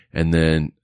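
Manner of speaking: normal talking voice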